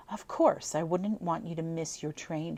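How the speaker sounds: ordinary delivery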